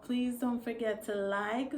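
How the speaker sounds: normal talking voice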